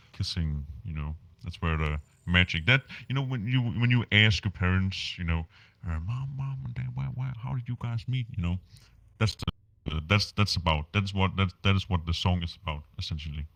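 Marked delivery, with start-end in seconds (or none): none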